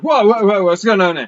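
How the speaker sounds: high, loud startled protest; startled alarm. Acted. Albion backing up in his chair, startled. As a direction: back off in alarm, pitch jumping up.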